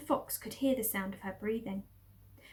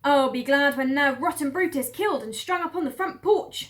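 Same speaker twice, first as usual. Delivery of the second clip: higher, louder big farm woman voice; Mabel calling out; 4 semitones higher, 8 dB louder than usual. Acted. Farm woman calls up from the cellar, loud and high. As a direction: call it up the cellar stairs, loud and coarse. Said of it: "she called out".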